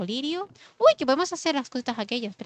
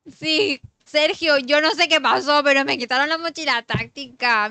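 loud complaint; 4 semitones higher, 7 dB louder than usual. Real, not acted. Indignant complaint to a friend.

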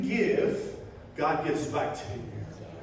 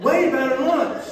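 high, loud laughing brag about the lunch; laughing gloat; 7 semitones higher, 6 dB louder than usual. Real, not acted. Teasing his wife over the lunch, laughing gloat. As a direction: crow it through a laugh.

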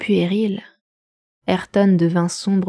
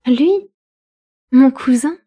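scornful; 5 semitones higher, 5 dB louder than usual. A narrator's reading. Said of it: "avec un rire méprisant".

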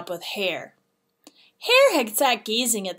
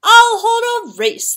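high, loud royal proclamation; excited announcement; 12 semitones higher, 11 dB louder than usual. A narrator's reading. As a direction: proclaim a bright idea to all, high and loud. Emperor announces his sudden idea.

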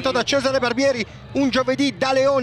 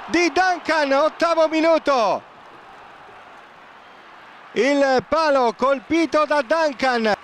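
Italian football commentary; live play-by-play. Acted. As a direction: call the action live, building to the post. Live play-by-play, post hit.

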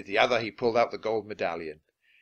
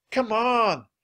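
high, louder call; urgent shout; 11 semitones higher, 6 dB louder than usual. A narrator's reading. Annie shouts from the treehouse window, urgent. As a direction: shout it to someone lagging below. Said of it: "Annie was shouting from the treehouse window".

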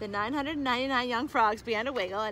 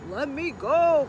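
loud, higher plea from a captured father; crying out; 4 semitones higher, 9 dB louder, slower than usual. A narrator's reading. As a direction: cry out loudly to be released. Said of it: "cried Father".

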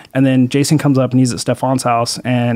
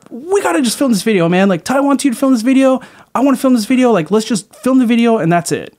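much higher pleading voice; placating plea; 10 semitones higher than usual. Retold or imitated. Calming the coworker to finish the video, nervous plea. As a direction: talk him down nervously. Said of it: "And I was like".

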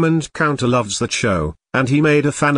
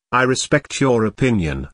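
normal talking voice

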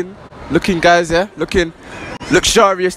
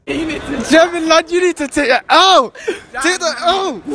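laughing taunt; 11 semitones higher than usual. Real, not acted. Mocking friend who lost.

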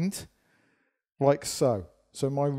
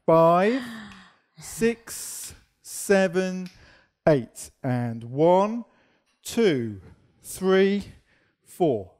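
dance teacher counting in; counting the beat; 3 semitones higher, much slower than usual. Shown as a demonstration. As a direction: count the steps out in time. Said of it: "to a count, here we go".

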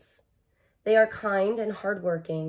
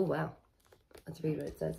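usual speaking voice

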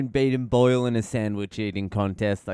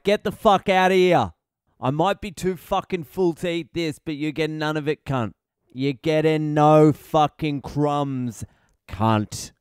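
higher, louder furious yelling; angry yell at bird; 4 semitones higher, 4 dB louder than usual. Retold or imitated. Guarding his sandwich from a thieving bird, angry higher louder yell. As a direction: shout the bird off your sandwich. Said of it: "I'm like".

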